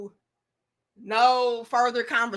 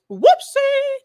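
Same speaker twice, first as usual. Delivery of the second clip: high squeaky mock oops; tiny high-pitched whoopsie; 7 dB louder than usual. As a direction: chirp it like a cartoon oops. Playful exclamation.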